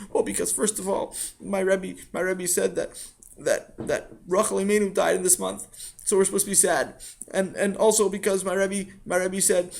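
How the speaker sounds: put-on high-pitched child's voice, halting; Sammy, earnest upset boy. Sammy explains his experiment to father, high and earnest.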